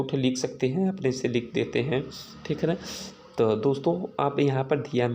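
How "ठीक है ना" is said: ordinary delivery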